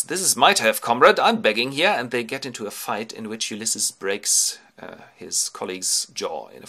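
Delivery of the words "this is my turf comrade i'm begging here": loud, raised challenge; territorial beggar. Acted. Rival beggar defending his turf, loud challenge. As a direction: warn a rival off loudly. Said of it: "another beggar enters and goes".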